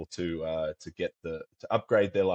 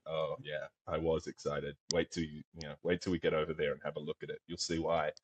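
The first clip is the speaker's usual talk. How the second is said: softer, understated aside; low-key retold reply; 3 semitones lower, 8 dB quieter than usual. Guide answering his client after the stalk, low-key and hushed. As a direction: play the answer down quietly.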